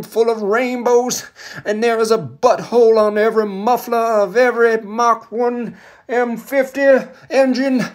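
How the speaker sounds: ringing mock oratory with long-held vowels; MLK impression. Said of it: "M L K".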